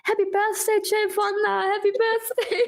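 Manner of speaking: imitating photographers calling out in a high-pitched voice; mock yelling. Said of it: "were yelling".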